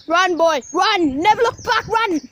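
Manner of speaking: loud, high hammed-up cry to flee; mock panicked yell. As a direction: yell at the boy to run, playing terrified. Pretend fleeing from gorilla.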